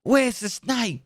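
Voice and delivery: high, hammed-up mock exclamation; army catchphrase. Trainee medic to snakebite patient, hammed-up high exclamation. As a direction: ham it up like a startled medic. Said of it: "And then you have to go".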